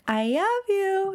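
put-on high, slow toddler voice; small child calling "I love you", L softened to Y; toddler daughter. Mother imitates daughter at bedtime, high toddler voice. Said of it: "she was like".

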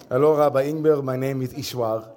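put-on light Israeli-accented English greeting. Rabbi mimicking ashram teacher introducing himself, light Israeli accent. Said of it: "I'm someone who's good at accents".

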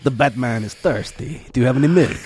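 hammed-up French accent Batman; mock French Batman asking. Friends riffing, Batman in a French accent.

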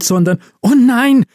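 high-pitched sudden alarm; panicked gasp. A narrator's reading. Elf realising the drugged candy is missing, panicked. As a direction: blurt it out as the horror dawns. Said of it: "keuchte Liv panisch auf".

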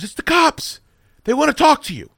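high wife voice. Retold or imitated. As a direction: mimic the anxious wife.

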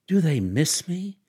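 thrilled and hungry for news; delighted question; ecstatic. A narrator's reading. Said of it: "she cried ecstatically".

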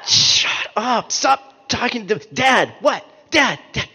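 put-on swatting away the nagging kid; annoyed dad.

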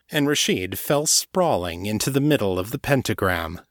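usual speaking voice

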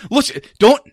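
high, broken-off protest; flustered stammer. Acted. Cutter scrambling to answer his mother, high flustered stammer. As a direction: start and abandon each excuse.

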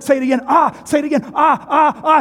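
high, loud coaching shout; hammed-up evangelist yelling. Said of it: "He said".

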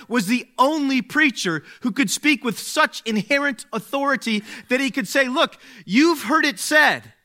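high, loud, driving preacher's voice; preaching at full force. Acted. Pastor to the congregation on Jesus' authority, preaching hard. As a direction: build the authority and hammer it home.

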